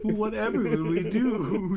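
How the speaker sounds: high, slow hammed-up concern; mock dismay. Real, not acted. GM mocks the looting with hammed-up dismay. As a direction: play it like a damsel.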